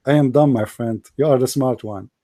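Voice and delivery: quiet mock agreement; sarcastic. Real, not acted. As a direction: concede with dripping sarcasm. Sarcastic after sigh.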